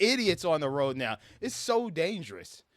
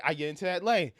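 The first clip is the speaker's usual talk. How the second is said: normal talking voice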